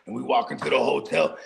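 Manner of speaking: ordinary talk, nothing marked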